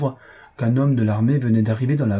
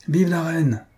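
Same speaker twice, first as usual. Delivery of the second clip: higher cry of the officers; a lightly raised group cheer; 7 semitones higher, slower than usual. A narrator's reading. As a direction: shout it as a toast. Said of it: "s'écrièrent les officiers".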